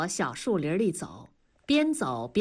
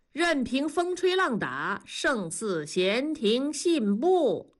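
drawn-out, higher, lilting verse; grand recitation; 6 semitones higher, slower than usual. A narrator's reading. Man quoting poetry to his teasing friend, grandly. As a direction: declaim the lines with airy unconcern.

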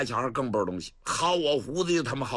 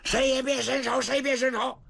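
shouting a warning; 10 semitones higher than usual. Acted. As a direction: stop the guards cold with your voice. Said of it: "程咬金就喊".

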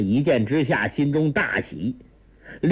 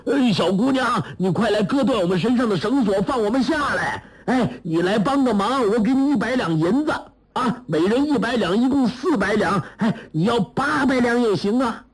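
much higher, urgent pleading; clamouring ghosts; 10 semitones higher than usual. A narrator's reading. Four tied-up villains beg a girl for release, clamouring. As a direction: clamour over one another to be freed. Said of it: "黄河四鬼纷纷叫嚷".

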